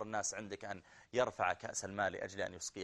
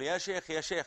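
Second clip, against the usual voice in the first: raised, higher call down the hall; 7 semitones higher, 4 dB louder than usual. Retold or imitated. Paralysed man calling to a passing sheikh, raised call.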